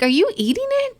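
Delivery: high, startled question; shocked. Retold or imitated. Mom catching her son sneaking candy, high startled question. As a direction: catch him red-handed in disbelief.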